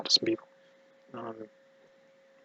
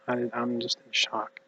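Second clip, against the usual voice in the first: ordinary delivery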